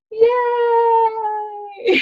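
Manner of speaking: high bright cheer; joyful yay. Acted. Cheer after chant.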